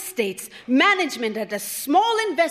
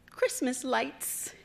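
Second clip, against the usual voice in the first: usual speaking voice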